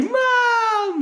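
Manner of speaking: high, drawn-out mock wail; imitated kid calling mom. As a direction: wail for mom like a little boy. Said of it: "then obviously he's like".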